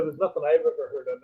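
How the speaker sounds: ordinary delivery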